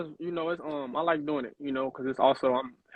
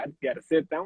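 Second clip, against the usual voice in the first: ordinary talk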